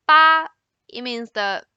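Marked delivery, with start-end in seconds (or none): 0.1-0.5 "ba" high, level and loud; sustained high level "ba"; first tone. Shown as a demonstration. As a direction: hold "ba" on a high flat pitch. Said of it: "So the first one".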